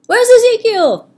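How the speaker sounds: high, loud call across the field. A narrator's reading. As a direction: call out for the missing crow. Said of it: "Zachariah called".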